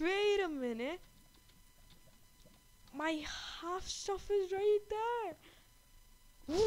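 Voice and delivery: sudden loud, high wait a minute; genuinely startled, calling it out; loud startled exclamation; real surprise. Real, not acted. Sees lost stuff in game.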